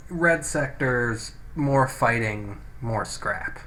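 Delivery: ordinary talk, nothing marked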